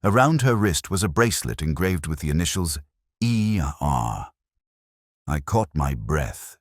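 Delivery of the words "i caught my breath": plain reading voice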